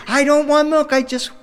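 put-on high, loud kid protesting; child refusing milk, high and loud; whiny toddler. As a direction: whine it like a stubborn two-year-old.

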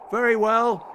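loud, high rallying shout; high-pitched, forceful cry. A narrator's reading. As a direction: call it out with sudden resolve. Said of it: "he shouted".

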